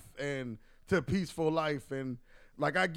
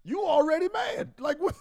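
incredulous; 12 semitones higher, 3 dB louder than usual. Real, not acted. Mocking someone angry at a date.

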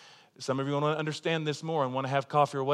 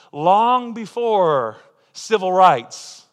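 louder, very slow, every word weighted; dramatic preacher emphasis; slow emphatic phrase; 2 semitones higher, 8 dB louder, much slower than usual. Real, not acted. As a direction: stretch it out for weight. Sermon emphasis on history.

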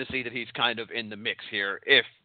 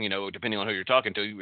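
usual speaking voice

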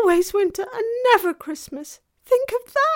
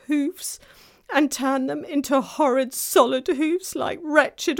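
higher, miserable faun voice; beginning to cry; 6 semitones higher, 7 dB louder than usual. A narrator's reading. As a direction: lament dreadful punishments as the tearful faun. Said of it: "beginning to cry again".

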